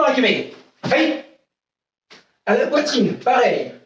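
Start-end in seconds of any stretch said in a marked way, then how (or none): 0.9-1.2 short sharp high kiai; kiai shout. Real, not acted. Karate teacher to online class, sharp high kiai shout. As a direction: snap out the kiai like a strike.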